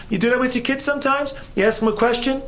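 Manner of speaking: ordinary talk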